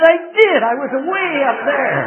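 high, loud punchline, stretched at the end; exclaimed joking retort. Retold or imitated. Traveler to his host about dropping from heaven, loud joking retort. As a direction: belt out the comeback over the laughter.

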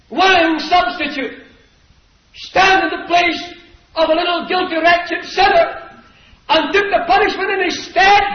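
high, impassioned gospel preaching. Real, not acted. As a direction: drive the message home from the pulpit. Preaches the gospel at full force.

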